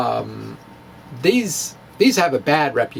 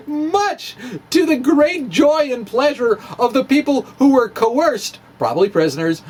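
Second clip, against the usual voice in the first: higher, laughing through the sarcasm; sarcastic laughing aside; 7 semitones higher than usual. Real, not acted. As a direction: chuckle your way through the dark irony. Sarcastic aside about coerced prisoners.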